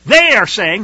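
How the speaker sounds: loud, high, emphatic protest; Yeshua. Acted. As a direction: deny it forcefully, voice pitched well up. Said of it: "what Yeshua himself said right off".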